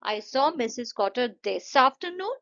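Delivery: slow sentence ending in a lift on "afternoon"; rising intonation. Shown as a demonstration. Teacher showing rising instead of falling, pitch climbing on "afternoon". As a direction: let "this afternoon" rise like a question. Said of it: "rising intonation इस sentence में कर दिया".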